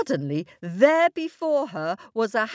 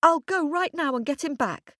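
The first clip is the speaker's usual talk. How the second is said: put-on high child voice, determined to make amends; tearful little girl; 8 semitones higher than usual. A narrator's reading. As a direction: offer eagerly like an upset child. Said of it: "wept the little girl".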